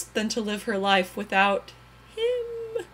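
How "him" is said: high, voice cracking on the word; breaking cry. A narrator's reading. Narrator naming the lost lover, voice breaking.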